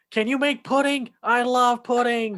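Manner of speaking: high, loud, overexcited plea; mock freak-out. Pirate sons begging Sheeta for pudding, freaking out. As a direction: gush it high, drawing out the end. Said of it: "the sons all freak out".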